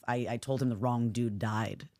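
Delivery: ordinary delivery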